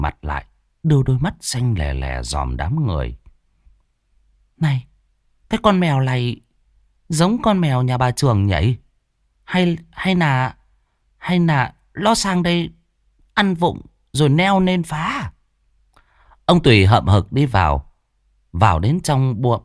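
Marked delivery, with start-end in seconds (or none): 4.6-15.3 wife's voice. Acted. Wife suspects the neighbour's cat, higher and hesitant. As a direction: wonder aloud, hesitant.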